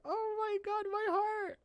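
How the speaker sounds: tiny, soft, very high-pitched swoon; touched squeal. Retold or imitated. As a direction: squeak it softly, as if heart-melted. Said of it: "I'm just like".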